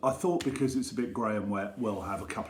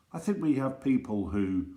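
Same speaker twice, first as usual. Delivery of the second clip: usual speaking voice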